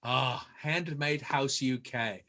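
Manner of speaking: low, slow, softened echo; hushed, drawn-out appreciation; savoring the name. Real, not acted. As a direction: draw it out with mock reverence. Host grandly echoes guest's handle.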